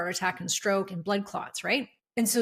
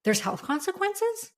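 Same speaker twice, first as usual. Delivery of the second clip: high, slow incredulous question; shocked, dead serious; 11 semitones higher than usual. Retold or imitated. Brother-in-law learning of health consequences, shocked. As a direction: imitate genuine disbelief, pitch way up. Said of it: "Like, dead serious".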